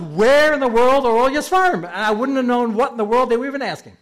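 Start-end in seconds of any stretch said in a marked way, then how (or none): 0.0-1.8 higher and louder accusing question; demanding shout. Retold or imitated. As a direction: call it out like the heavenly court. Heavenly court demanding.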